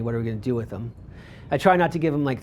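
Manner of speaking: ordinary talk, nothing marked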